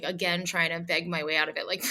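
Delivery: ordinary delivery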